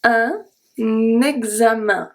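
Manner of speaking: un examen, slow, with the nasal un; French teacher modelling un examen; French pronunciation. Shown as a demonstration. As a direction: say un examen slowly and clearly. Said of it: "So yeah".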